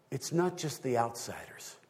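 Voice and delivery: ordinary talk, nothing marked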